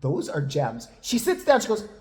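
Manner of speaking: ordinary delivery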